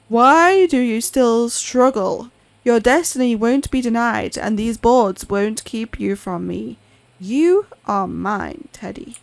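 looming voice calling his servant; ominous master. Acted. Master taunting trapped Teddy.

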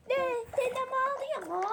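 high, loud startled question; acted alarm. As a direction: cry out in mock shock. Another take of the reaction.

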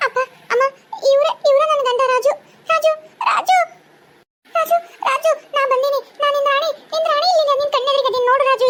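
high, pleading calls to a husband who can't hear; desperate pleading. Acted. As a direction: beg him to hear you. Ghost wife pleads with unhearing husband.

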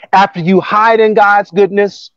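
ordinary talk, nothing marked

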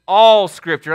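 ALL hit loud and hard; stress on ALL. Acted. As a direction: punch the word ALL. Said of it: "underline that word all".

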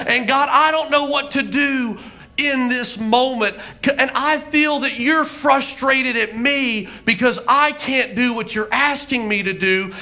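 high, pleading cry to God; impassioned acted-out prayer; desperate prayer. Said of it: "say one of my prayers".

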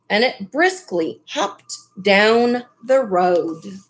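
ordinary delivery

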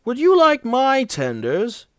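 high-pitched, guileless question; lilting, rising mock-innocent offer. A narrator's reading. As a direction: make the offer all innocence. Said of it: "asked Duck innocently".

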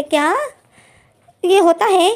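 can't believe what he's hearing; shocked disbelief. Retold or imitated. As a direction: pitch jumps up in shocked disbelief. Shocked at being told it's normal.

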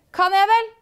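high, loud defiant comeback; defiant retort. Acted. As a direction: shoot back a defiant challenge.

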